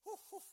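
loud celebratory whoo; quick mock cheer of excitement; excited whoop. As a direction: whoop it like a new car owner. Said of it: "it's an exciting time".

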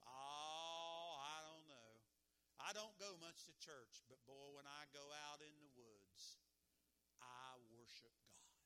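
a hint of a drawn-out, slowing excuse; a mild folksy reluctant churchgoer; lightly voiced churchless man. Man explaining why he skips church.

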